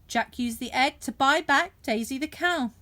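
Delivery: ordinary delivery, nothing marked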